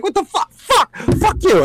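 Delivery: high-pitched cursing at the screen; retold angry yelling. Fan retelling yelling at the holding lineman, angry yell. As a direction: relive the outrage, voice leaping up. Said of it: "I'm, like, yelling at".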